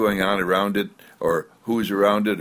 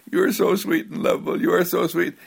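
put-on higher, faster sing-song praise to a baby; cooing to a baby; 8 semitones higher, faster than usual. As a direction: gush over the child in baby talk. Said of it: "you say".

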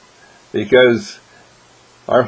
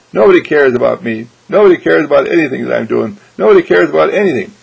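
louder and higher, fast whining protest; self-pitying outburst; 3 semitones higher, 6 dB louder, faster than usual. Acted. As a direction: wail that nobody cares, building up. Sulking family member complains.

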